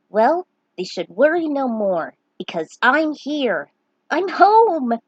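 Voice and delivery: loud and building to a high, joyful cry; excited homecoming. Acted. Lunith seeing his village after the journey, building to an excited shout. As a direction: call it out, thrilled to be home at last.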